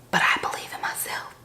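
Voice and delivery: hushed, unvoiced-sounding whisper; soft confiding whisper; whispered aside. Real, not acted. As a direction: whisper it like a private pep talk. Mock-confiding aside after boasting.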